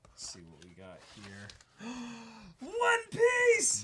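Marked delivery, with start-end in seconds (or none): 1.8-2.5 a sharp, high intake of breath; startled happy gasp; excited gasp. Real, not acted. Opening a surprise book. 2.6-3.8 much higher and louder, a delighted yell; thrilled cry at the reveal; excited shout. Real, not acted. Recognizes the gift book.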